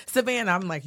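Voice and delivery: ordinary delivery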